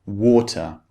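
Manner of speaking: "Water" with a very clear t; British T. Shown as a demonstration. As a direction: hit "Water" firmly with a distinct British t. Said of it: "very clearly pronounced".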